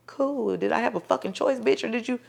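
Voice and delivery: muttered aside. Retold or imitated. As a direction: mutter it to yourself behind her back. Muttering after doctor leaves.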